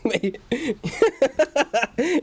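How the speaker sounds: long high real laugh; cracking up at the chat. Real, not acted. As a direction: burst out laughing.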